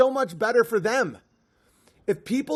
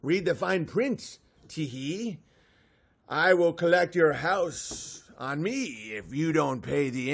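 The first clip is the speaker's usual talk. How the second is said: a trickster reciting the loan terms as verse; leprechaun rhyme; 4 semitones lower than usual. Acted. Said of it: "They're like".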